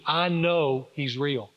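slow, deliberate "I know he's real"; slow conviction. Retold or imitated. Father answering his son's doubt, slow conviction. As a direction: weigh out each word with certainty.

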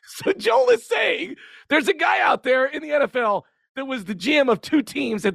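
high, exclaiming disbelief; incredulous outburst. Real, not acted. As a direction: burst out high at the absurdity. Mock disbelief at absurd story.

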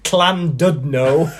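slow, over-proper Welsh CLAN DUDNO; exaggerated Welsh pronunciation. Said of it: "it would be weird to live in Manchester and then go".

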